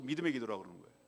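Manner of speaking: ordinary talk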